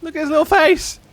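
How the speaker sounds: high cooing over a cute face; overwhelmed by cuteness. Real, not acted. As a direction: gush at it, high and loud.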